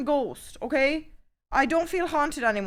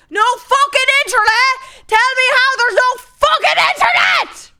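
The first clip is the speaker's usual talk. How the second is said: loud, high raging rant at the computer; real meltdown over dead internet; furious shouting; 6 semitones higher, 8 dB louder than usual. Real, not acted. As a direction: yell it, losing your temper completely. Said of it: "I'm actually getting fucking mad".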